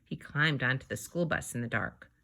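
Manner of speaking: ordinary delivery, nothing marked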